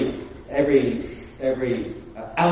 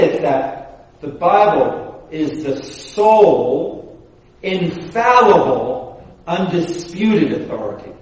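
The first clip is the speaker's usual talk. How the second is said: slow, deliberate; slower than usual. Shown as a demonstration. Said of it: "You understand the difference?".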